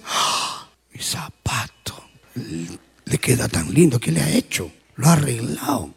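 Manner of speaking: jealous murmur. Retold or imitated. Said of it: "lo ve con el zapato y dice".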